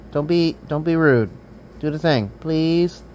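ordinary delivery

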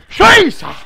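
high, loud outburst; startled exclamation. Real, not acted. Boxer reacting mid-fight, startled shout. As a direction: blurt it out at full voice.

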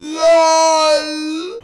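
high, loud drawn-out shout; long yell. Pretend. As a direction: stretch it into one long holler. Yells after pressing button.

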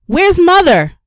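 panicked high shout; urgent yell across the street. A narrator's reading. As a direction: call out in alarm for her mother. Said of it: "Mama yelled".

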